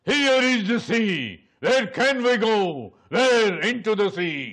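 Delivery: high, slow calling out; dramatic film cry. Shown as a demonstration. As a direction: cry out slowly across a great distance. Crowd cries out in film scene.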